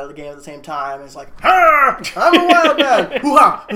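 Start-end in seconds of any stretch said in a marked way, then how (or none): 1.4-3.2 high, loud manic outburst; Robin Williams. Retold or imitated. Imitating Alan leaping out of the game, manic yell. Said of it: "In his Robin Williams way". 3.2-3.6 loud, high manic whoop; a big zany whoop; Robin Williams. Retold or imitated. Imitating Alan fresh from the jungle, manic whoop. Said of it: "In his Robin Williams way".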